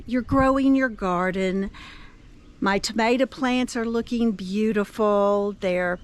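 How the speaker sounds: ordinary delivery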